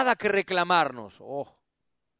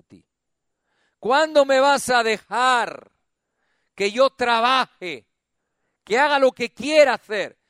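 voz de Dios suplicante; 3 semitones higher, 6 dB louder than usual. Acted. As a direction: plead like a weary father, loud. Dios habla al predicador, predicando. (voz de Dios, fuerte y suplicante)

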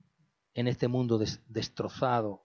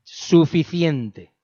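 slow, drawn-out stern word; a father's slow, heavy warning; slow stern fatherly warning; 4 semitones higher, 11 dB louder, much slower than usual. Retold or imitated. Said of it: "Hacía así".

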